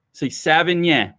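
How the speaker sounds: Savagnin with a nasal French ending; Savagnin, nasal final vowel. Shown as a demonstration. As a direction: push the end of Savagnin through the nose. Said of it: "it's real nasally".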